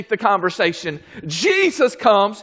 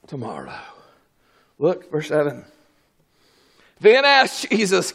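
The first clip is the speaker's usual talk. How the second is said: usual speaking voice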